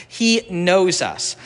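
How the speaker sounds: slow, weighty, drawn-out ending; slow emphatic. Real, not acted. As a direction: hold each word slowly. Slow emphatic preaching line.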